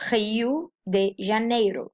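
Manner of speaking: Rio with an H-like R, Janeiro with a tapped R; Portuguese pronunciation. Shown as a demonstration. Teacher pronouncing the city, both Portuguese R sounds in Rio de Janeiro. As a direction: say Rio as "Hee-oo", tap Janeiro's R. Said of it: "we can pronounce the city".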